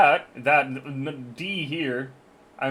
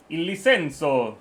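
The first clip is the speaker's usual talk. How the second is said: put-on Italian accent; slower than usual. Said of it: "There will be an Italian accent".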